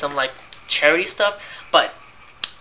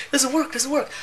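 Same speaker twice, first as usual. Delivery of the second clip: mock frustrated customers; 10 semitones higher than usual. Customers quoted, high voice.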